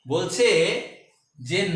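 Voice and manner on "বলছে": ordinary talk, nothing marked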